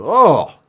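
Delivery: high, loud grossed-out cry; disgusted "oh". Real, not acted. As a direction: recoil with a loud "oh". Reacts to missing flesh.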